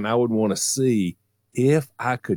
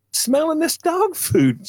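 put-on much higher, slow child voice; kid's answer; slower than usual. Boy answering his mother at the door, high and slow. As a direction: say it like an innocent little boy. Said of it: "I said".

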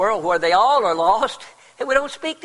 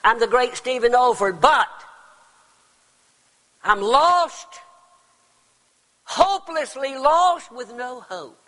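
preacher voicing a doomed man, higher and louder; retold despair; 7 semitones higher, 5 dB louder than usual. Preacher retelling Olford lost at night, rising despair. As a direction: cry out Stephen Olford's dread as if lost in the grass. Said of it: "And he said".

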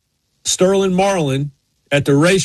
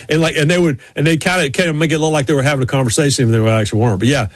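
ordinary talk

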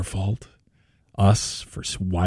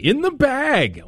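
high, slow echo of "In the bag"; mock-awed echo; 13 semitones higher, slower than usual. Host echoing the caller's boast in a high, slow mock-awed voice. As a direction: repeat it way up high, drawn out in mock awe. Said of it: "Wow. That is confidence".

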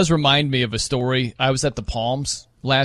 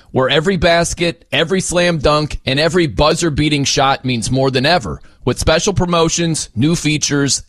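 polished, punchy sponsor read; 7 dB louder than usual. Acted. Host reading a BetMGM sponsor spot, ad read. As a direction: sell it firmly, phrase by phrase.

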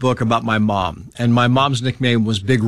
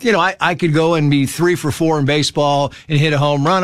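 ordinary talk

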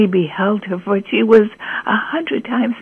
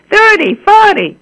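high, louder bid from the crowd; calling a bid; 12 semitones higher, 6 dB louder than usual. Acted. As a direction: call out the price over the crowd. Bidders in the crowd.

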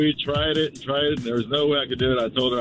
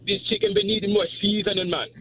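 higher Jamaican cooking-show voice; Jamaican accent; 10 semitones higher than usual. Pretend. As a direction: Jamaican chef hyping the pot. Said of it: "like, a Jamaican accent".

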